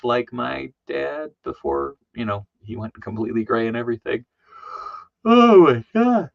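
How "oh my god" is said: high, loud, slow "oh my God"; much higher and louder, drawn-out disbelief; shocked exclamation. Real, not acted. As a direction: stretch it out in disbelief. Reaction to his dad's doll.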